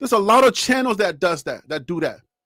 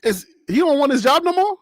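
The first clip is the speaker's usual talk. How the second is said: usual speaking voice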